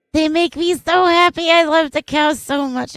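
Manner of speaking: high, loud squeal of joy; squealing delight. Real, not acted. Streamer squealing over cows, high and loud.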